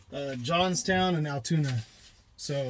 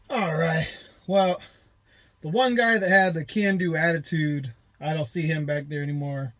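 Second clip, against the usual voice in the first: ordinary talk, nothing marked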